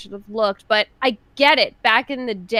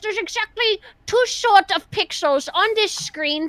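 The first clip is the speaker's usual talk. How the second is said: troll voice; 5 semitones higher than usual. Acted. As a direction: put on a snooty troll voice. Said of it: "I like your troll voice".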